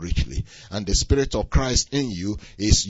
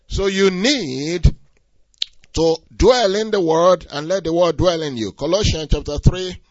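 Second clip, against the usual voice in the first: loud, higher, driving sermon voice; full-force preaching; 8 semitones higher, 7 dB louder than usual. Acted. Pastor exhorting the congregation, loud preaching. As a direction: preach it out with fire.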